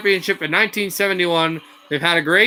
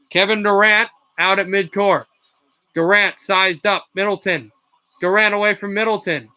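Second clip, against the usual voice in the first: energetic basketball call; broadcast-style play-by-play; live play-by-play; 3 semitones higher than usual. Acted. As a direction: ride the action as it happens.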